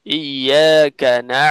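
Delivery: ইয়াকা না very slow and pressed, the ain squeezed in the throat; ain demo. Shown as a demonstration. Teacher demonstrating the throat catch of ain. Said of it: "আমরা বলি না".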